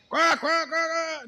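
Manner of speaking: loud high clucking hen call; hen cackle. Retold or imitated. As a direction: cackle like a hen that just laid an egg. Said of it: "sai gritando".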